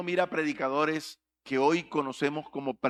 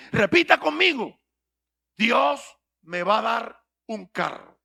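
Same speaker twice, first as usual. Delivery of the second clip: mocking prosperity preacher; 4 semitones higher, 4 dB louder than usual. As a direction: parody a preacher leading the crowd. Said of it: "Pero ellos dicen así". (imitando burlón a un predicador de la prosperidad)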